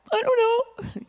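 a bewildered little I don't know; high puzzled voice. Retold or imitated. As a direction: squeak it out, unsure and high. Confused reply to pastor.

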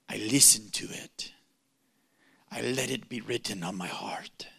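hushed whisper in Mike's voice; whispered impression. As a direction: whisper it low as a sacred secret. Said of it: "I'm not gonna do voice impersonations".

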